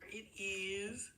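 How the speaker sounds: breathy whispered aside to the viewers; playful whisper. Real, not acted. Whispering to the live viewers as the stream appears, playful whisper. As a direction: whisper it like a little secret.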